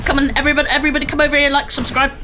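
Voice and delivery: loud, fast channel promo; hammy streamer plug. Pretend. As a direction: shout the self-promo like an ad.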